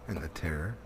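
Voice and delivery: ordinary delivery, nothing marked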